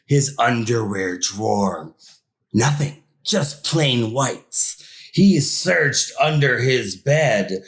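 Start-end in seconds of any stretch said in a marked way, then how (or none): none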